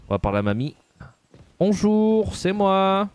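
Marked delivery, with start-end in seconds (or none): 1.6-3.1 sing-song greeting. Pretend. Greeting the old woman cheerfully.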